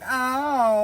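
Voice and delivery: mock swoon moan. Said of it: "being like".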